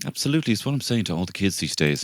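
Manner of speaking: ordinary delivery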